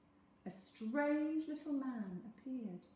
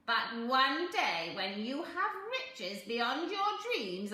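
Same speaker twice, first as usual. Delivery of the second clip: sly little man; 4 semitones higher, 8 dB louder than usual. A narrator's reading. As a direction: bargain like a crafty imp.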